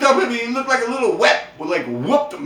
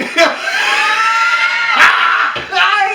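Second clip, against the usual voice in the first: long high-pitched cackle; real uncontrollable high laugh; hard laughing; 5 dB louder than usual. Real, not acted. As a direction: crack up loudly at your own story. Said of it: "I'm laughing so fucking hard".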